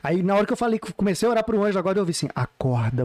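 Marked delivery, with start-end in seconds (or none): 2.3-3.0 low, drawn-out mysterious call; lightly voiced voice from inside the head; imitated woman's voice. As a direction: drop low for a one-word command. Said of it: "uma voz de mulher".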